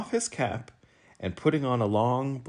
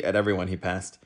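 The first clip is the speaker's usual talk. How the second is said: usual speaking voice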